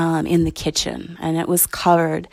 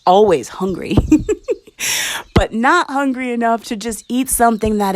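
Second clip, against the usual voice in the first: normal talking voice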